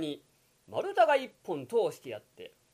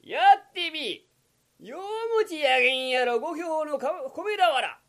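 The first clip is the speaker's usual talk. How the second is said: high, loud jeering merchant voice; taunting shopkeeper; 9 semitones higher, 6 dB louder than usual. Acted. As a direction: goad him, voice pitched up and loud. Kokichi taunts the yokozuna. (甲高く挑発する商人の声)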